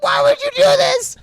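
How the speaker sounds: very high, loud wail; distraught wife. Retold or imitated. Wife to the staff after the cutting, distraught scream. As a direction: shriek it in mock despair. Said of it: "the wife is like next".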